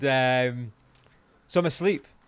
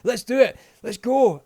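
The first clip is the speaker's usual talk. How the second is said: hyped-up American, high and loud; American accent; 9 semitones higher, 8 dB louder than usual. Retold or imitated. American stranger right in his face, imitated hyped American accent. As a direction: imitate an over-eager American pumping someone up. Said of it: "American as well".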